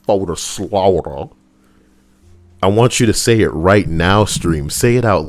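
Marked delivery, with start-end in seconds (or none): 0.0-1.3 slow ominous. Acted. Dramatic slow.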